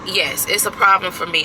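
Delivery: ordinary talk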